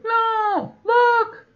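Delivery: put-on very high, loud toddler squeal; squeaky baby voice. Old guy showing the kids, very high loud squeal. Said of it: "then he's like".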